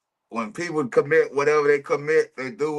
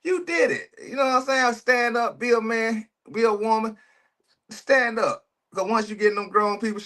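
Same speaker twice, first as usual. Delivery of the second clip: scolding; 9 semitones higher than usual. Real, not acted. Scolding the suspect, raised voice.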